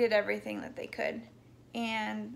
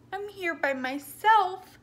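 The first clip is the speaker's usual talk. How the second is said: upset; 8 semitones higher, 6 dB louder, slower than usual. Real, not acted. Alone as emergency birth begins.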